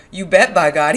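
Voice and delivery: loud, delighted outburst; happy cry. A narrator's reading. Lenny agreeing about velvet, happy cry. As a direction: cry it out happily. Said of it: "he cried happily".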